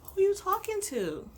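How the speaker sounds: high, slow incredulous comeback. Real, not acted.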